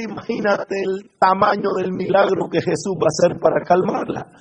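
usual speaking voice

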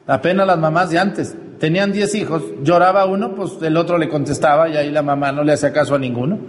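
usual speaking voice

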